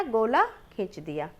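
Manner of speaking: ordinary talk, nothing marked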